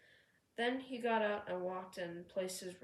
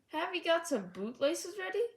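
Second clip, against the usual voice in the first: rude teasing; 8 semitones higher, 5 dB louder than usual. A narrator's reading. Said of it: "laughing rudely".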